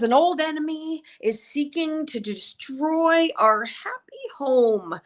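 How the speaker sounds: crazy visionary, high voice slowing at the end; Drusilla. Acted. Drusilla has a vision of an enemy, crazy high voice. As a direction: voice a mad seer, high and drawn out at the close. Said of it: "one of her crazy ones".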